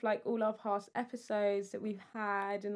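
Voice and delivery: ordinary talk, nothing marked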